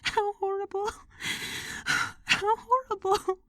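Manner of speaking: a grieving woman breaking down; sobbing out her horror at the death; tearful cry. Acted. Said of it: "burst into tears".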